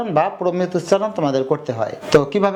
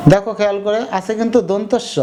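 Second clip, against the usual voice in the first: ordinary talk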